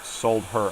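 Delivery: sold her stressed on sold, in fun; jokey sold her. Shown as a demonstration. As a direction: quote his own sold her. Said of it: "you have to say "sold her".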